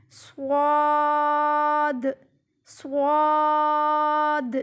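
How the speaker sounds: صاد as a heavy "swaad", held long; full-mouthed whistled s on صاد, stretched level; thick saad. Shown as a demonstration. Teacher modelling a thick letter, heavy drawn-out صاد. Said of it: "موٹے حرفوں میں سے ہے".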